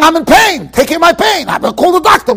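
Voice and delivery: high, urgent mock cry of pain; mock screaming. Imitated patient demanding help for his pain, mock screaming. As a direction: cry out like a frantic patient, half in earnest. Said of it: "The guy is screaming out".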